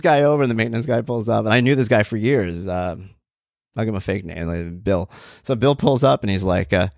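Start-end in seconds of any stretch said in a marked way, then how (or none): none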